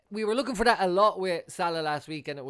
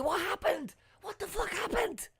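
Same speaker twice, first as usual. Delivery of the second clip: high-pitched what happened; can't believe what you missed; shocked disbelief; 3 dB quieter than usual. Real, not acted. Fan who missed the replay, shocked and high.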